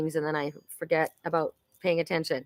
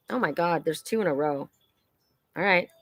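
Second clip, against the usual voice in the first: ordinary delivery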